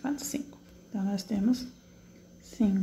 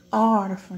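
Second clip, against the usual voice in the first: "órfão" with a loud, held ÓR; stress on first syllable; 6 dB louder, slower than usual. Shown as a demonstration. Teacher showing the strongest syllable, ÓR stressed. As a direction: punch the ÓR of "órfão". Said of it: "minha sílaba mais forte".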